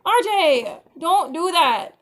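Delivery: high, loud warning; scolding shout. Real, not acted. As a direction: mom yelling at her son. Mom scolding son R J.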